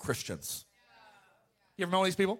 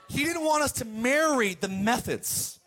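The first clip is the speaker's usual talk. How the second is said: slow, loud preaching shout; 4 semitones higher, 4 dB louder than usual. Real, not acted. Preacher to congregation, a slow loud shout. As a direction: drive the point home slowly and loudly.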